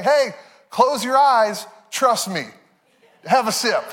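high, slow, sing-song coaxing; playful mock-sweet invitation; coaxing tease. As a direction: lure someone into trusting you blindly. Life and God tempting us.

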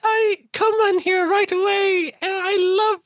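very high, slow falsetto plea; pretend Isabella. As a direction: plead like a damsel in falsetto. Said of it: "pretend to be Isabella".

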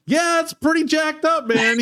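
high mock-scornful retort; Bill dismissing the kid's old car, mock scornful; dismissive. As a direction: toss it off like the car is junk. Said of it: "He's like".

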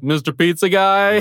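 slow, spaced-out surfer drawl; dopey dude voice. Retold or imitated. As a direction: stretch it out like a stoned pizza guy. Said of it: "He's like".

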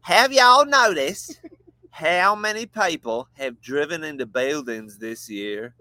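slow, badly faked Southern drawl; attempted Southern accent. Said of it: "you should read it in Southern".

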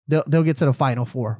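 ordinary talk

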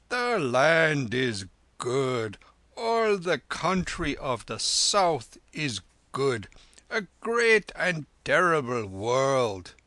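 put-on very slow, drawn-out old lama; drowsy mumble. A narrator's reading. Lama half asleep musing on the country, drowsy drone. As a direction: drift sleepily through stretched words. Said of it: "mumbled the lama drowsily".

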